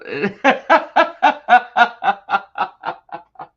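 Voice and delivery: loud helpless laughing fit; pained high cackle; laughing through pain. Real, not acted. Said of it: "it hurts to laugh".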